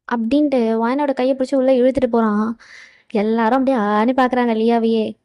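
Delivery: usual speaking voice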